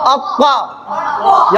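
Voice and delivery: अक्का thick and rounded, stretched out slowly; मोटी आवाज. Shown as a demonstration. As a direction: draw out a full-mouthed heavy अक्का. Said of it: "मोटी आवाज में". (अक्का को मोटा करके, खींचकर)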